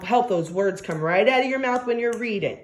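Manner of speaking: ordinary delivery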